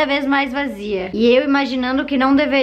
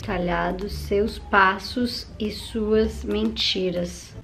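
repetindo devagar, mais grave e mais baixo; lento e baixo; 5 semitones lower, 6 dB quieter, much slower than usual. Real, not acted. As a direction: saboreie cada palavra, devagar. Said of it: "repete devagar". (relendo a frase devagarinho)

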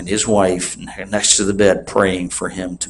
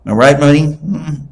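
ordinary talk

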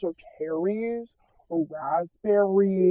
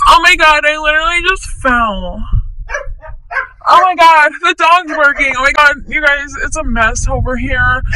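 loud, high hammed-up frazzle; mock panic; 4 semitones higher, 8 dB louder than usual. Frazzled vlogging mom tells viewers about the chaos, hammed-up panic. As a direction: play the overwhelmed mom for laughs. Chaotic mom vlog mishap.

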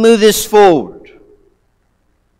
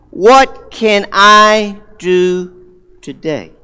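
slow, deliberate question; much slower than usual. Shown as a demonstration. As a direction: stretch each word out slowly. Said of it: "Maybe things like this".

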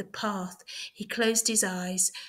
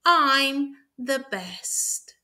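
slow boast; 5 semitones higher, 5 dB louder, much slower than usual. A narrator's reading. Hare boasting after winning.

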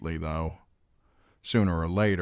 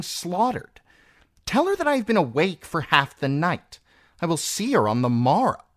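high, imperious exasperation; an irritated queen issuing orders; snapped; 14 semitones higher, 4 dB louder than usual. A narrator's reading. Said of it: "snapped Circe".

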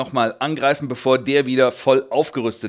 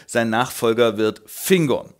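ordinary delivery

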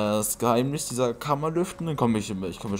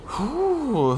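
relieved exhale; 5 dB louder than usual. Real, not acted. Relief after making the jump.

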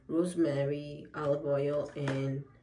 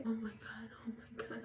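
breathless whispered oh my God; hushed, stunned relief; real whispered shock; whispered disbelief; 6 semitones higher, 9 dB quieter than usual. Real, not acted.

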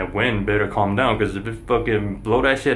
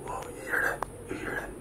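real hushed whisper; 14 dB quieter than usual. Real, not acted. Vlogger alarmed by a noise up high, whispering. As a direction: ask it barely audibly, on breath alone.

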